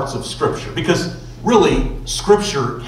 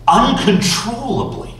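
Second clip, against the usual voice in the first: ordinary talk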